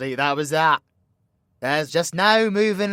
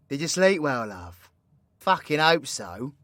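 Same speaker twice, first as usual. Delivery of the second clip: ordinary talk, nothing marked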